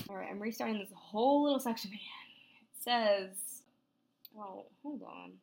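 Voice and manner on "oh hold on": ordinary talk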